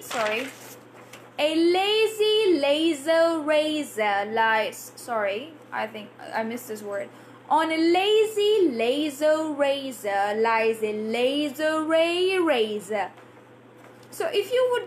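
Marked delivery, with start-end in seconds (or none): 1.4-4.9 A lazy laser razor lies, slowed and stretched; slow drill. Shown as a demonstration. Teacher reading a tongue twister slowly for students. 7.5-13.1 A lazy laser razor lies, slowed and stretched; slow drill. Shown as a demonstration. Teacher reading a tongue twister slowly for students.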